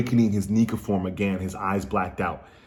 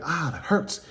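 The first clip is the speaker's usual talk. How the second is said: higher-pitched cartoon yelp of pain; hurt character; 7 semitones higher, slower than usual. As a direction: act out a mild cartoon ouch. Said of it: "Luffy's like".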